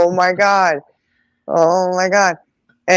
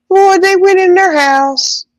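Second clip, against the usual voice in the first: amazed exclamation; 13 semitones higher, slower than usual. Real, not acted. Telling the shocking part of the story.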